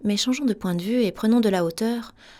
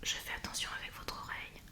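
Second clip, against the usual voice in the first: whispered right by the ear; close whisper; 18 dB quieter than usual. Shown as a demonstration. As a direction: lean in and whisper softly. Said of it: "Je m'approche de vous". (chuchotement tout près de l'oreille)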